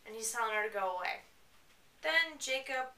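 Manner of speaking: ordinary talk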